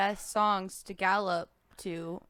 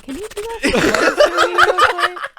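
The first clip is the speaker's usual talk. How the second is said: laughing; 11 semitones higher, 16 dB louder than usual. Retold or imitated. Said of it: "About to call her, like".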